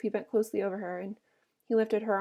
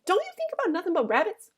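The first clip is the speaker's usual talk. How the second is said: higher-pitched annoyed outburst; angry; 9 semitones higher than usual. A narrator's reading. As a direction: snap in exasperation, pitched well up. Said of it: "Curly's wife said angrily".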